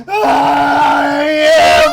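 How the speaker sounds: loud gleeful yell; gleeful shout. Real, not acted. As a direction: shout it with delight. Gleeful confirmation.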